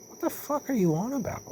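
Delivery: high-pitched mock bafflement; incredulous. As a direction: pitch it way up, retelling your disbelief. Retells his baffled reply to guard.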